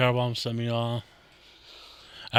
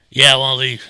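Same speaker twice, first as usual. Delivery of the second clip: imitating a drunk; 10 dB louder than usual. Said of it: "I was like".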